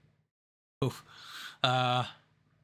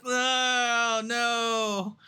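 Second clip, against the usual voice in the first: high, drawn-out playful wail; mock dismay; 8 semitones higher than usual. Co-host seeing the share price drop, high mock dismay. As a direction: stretch it out into a comic lament.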